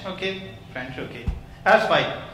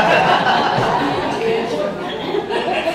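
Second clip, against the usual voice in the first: amused laugh; 7 semitones higher, 4 dB louder than usual. Real, not acted. Speaker laughs at own joke.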